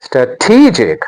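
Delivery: "strategic" slowed, with a long TEE; stress on second syllable. Shown as a demonstration. Teacher modelling the next word, "strategic" slowly stressed stra-TEE-jic. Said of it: "The stress is on".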